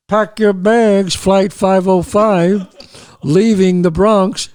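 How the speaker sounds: loud, slow mock flight announcement; airline announcer. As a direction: boom it out slowly like a boarding call. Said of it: "you would sound like, uh, he, like Pan American Airlines".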